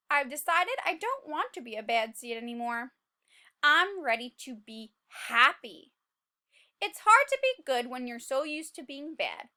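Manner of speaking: lightly voiced earnest seed; hopeful narration. A narrator's reading. Seed telling the class he wants to change, earnest narration. Reading the story aloud.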